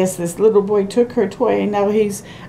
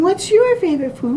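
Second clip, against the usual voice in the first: put-on raised storybook girl voice; girl character voice; 9 semitones higher than usual. Reading the girl's question aloud, lightly voiced. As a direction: ask it like the little girl. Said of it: "She's asking".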